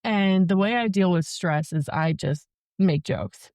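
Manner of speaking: ordinary talk, nothing marked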